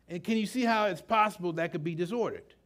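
usual speaking voice

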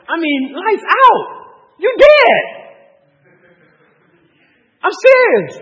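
real protective fury, shouted high; fierce outburst. Real, not acted. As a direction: blow up at whoever touched her. Imagining someone wronged his wife.

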